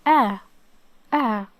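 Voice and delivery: A as a short, loud syllable; A shown clearly. Shown as a demonstration. Teacher showing Alif with Fatha, short loud A. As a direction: sound out A firmly, then repeat. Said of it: "so it's pronounced like".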